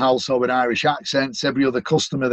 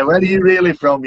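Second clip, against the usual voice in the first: sceptical question; 5 semitones higher, 5 dB louder than usual. Retold or imitated. Echoing the Irish question, laughing.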